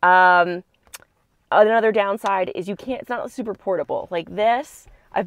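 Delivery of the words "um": ordinary talk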